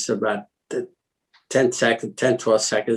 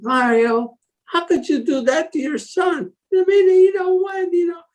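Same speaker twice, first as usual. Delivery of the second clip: wife's outraged reproach. Retold or imitated. Wife scolding Mario for beating their son, high indignant voice. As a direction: pitch way up, indignant and tearful. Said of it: "my wife, the answer is".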